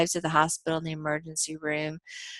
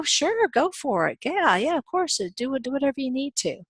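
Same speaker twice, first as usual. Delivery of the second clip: higher, bright-toned eager agreement; eager yes; 7 semitones higher than usual. Retold or imitated. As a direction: play the overly helpful homeowner, higher. Owner cheerfully allows worker's request.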